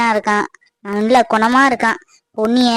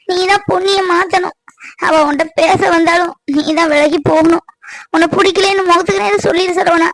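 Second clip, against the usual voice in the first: higher, tearful begging, voice catching in sobs; desperate tearful plea, pitched up; pleading through tears; 4 semitones higher than usual. Acted. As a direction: beg him while crying. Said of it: "அழாதீங்க அத்தை".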